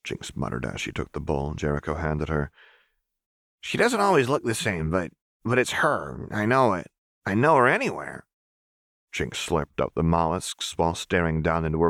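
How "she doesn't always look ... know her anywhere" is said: put-on higher, lighter voice of a strange young girl. Narrator voicing Jinx, insistent young girl. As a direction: voice the girl insisting she knows her.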